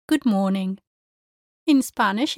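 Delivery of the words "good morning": emphasis on "morning" rather than "good"; stress on MORNING. Shown as a demonstration. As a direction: lean on the word "morning". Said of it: "you clearly emphasize the word morning".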